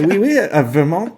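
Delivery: quick hammed-up French; French accent. Analyst reprising the French voice for co-host. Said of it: "where's our French guy from".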